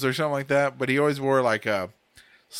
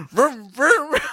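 put-on high, loud kid's toy-car vroom; car noise; 13 semitones higher, 8 dB louder, slower than usual. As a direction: push a toy car along the carpet. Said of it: "he goes".

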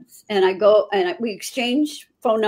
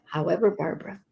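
whisper; 2 semitones lower, 5 dB quieter than usual. Retold or imitated. Arnold softening the verdict.

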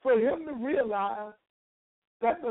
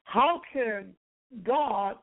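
ordinary talk

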